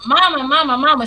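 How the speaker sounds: lightly voiced boy crying out for mom; mild mock yell. Boy runs in calling his mother, mild mock yell. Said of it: "came into the house screaming".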